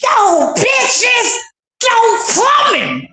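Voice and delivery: high, dragged-out yelled reply; retelling a shocked, high, slow yell at livestream viewers; mock outraged yell. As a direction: stretch it out slowly like a shocked clapback. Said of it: "I was like".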